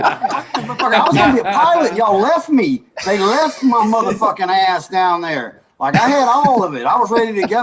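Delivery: loud, high-pitched indignant rant; mock outrage. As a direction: protest loudly at being abandoned, half laughing. Comic complaint about being left behind.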